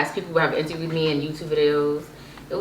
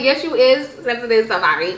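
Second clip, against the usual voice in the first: teasing; 6 semitones higher, 4 dB louder than usual. Retold or imitated. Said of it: "I'm like".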